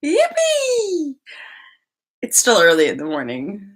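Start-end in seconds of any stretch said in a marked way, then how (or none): 0.0-1.1 high squeal of cheer; forced yippee. Real, not acted. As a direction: force the excitement a little. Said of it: "That yippee just felt a little bit forced".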